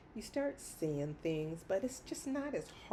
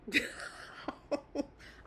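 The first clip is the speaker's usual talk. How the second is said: laughing; 8 semitones higher than usual. Real, not acted. Laughs at own story.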